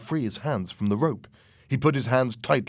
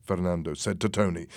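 ordinary delivery, nothing marked

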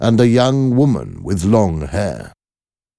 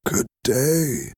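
higher, softer, feeble greeting; weak voice; 6 semitones higher, 5 dB quieter than usual. Acted. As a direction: answer faintly like a broken old man. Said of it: "replied in a weak voice".

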